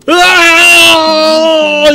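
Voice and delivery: long anguished shout; drawn-out yell. Real, not acted. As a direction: let out a long loud cry. Frantic moment in game.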